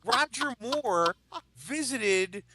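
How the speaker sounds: high, drawn-out indignant rant; mock outrage. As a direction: protest theatrically, stretching the key words. Heated mock argument over Bond theory.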